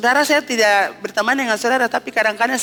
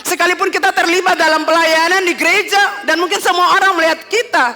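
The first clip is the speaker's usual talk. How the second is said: louder, higher preaching at full force; 5 semitones higher, 4 dB louder than usual. Acted. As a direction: drive the call to repent home. Climax of the sermon. (berkhotbah dengan lantang dan berapi-api)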